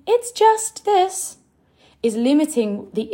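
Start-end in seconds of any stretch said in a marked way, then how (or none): 0.1-1.4 high, drawn-out dismissive mimicry; mock belittling. Coach showing how "just" limits a message, high mock-dismissive. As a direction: shrink it with a high, slow put-on voice. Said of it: "is a limiting word".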